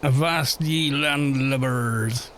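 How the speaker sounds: put-on slow drawn-out old sailor; lightly voiced pirate captain. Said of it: "I don't quite get the pirate accent".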